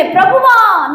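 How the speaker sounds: high pleading cry; mock cry of a sinking man. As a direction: call out to the Lord in panic. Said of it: "కేకలు వేసెను".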